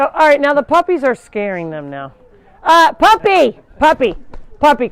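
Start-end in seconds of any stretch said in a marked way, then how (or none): 2.6-4.9 high, loud call across the pen. Real, not acted. Owner calling her puppy away from the goats, loud. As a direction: raise your voice to call the dog back.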